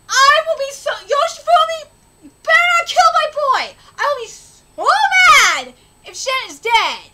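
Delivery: real outraged yelling; angry shout. Real, not acted. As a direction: yell threats at the villain. Threatening game villain.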